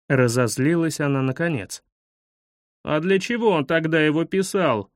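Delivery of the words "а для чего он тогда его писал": usual speaking voice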